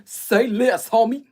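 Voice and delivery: put-on very loud, cocky Compton accent. Streamer imitating cocky pit-bull men, loud Compton accent. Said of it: "stand real cocky just like them pit".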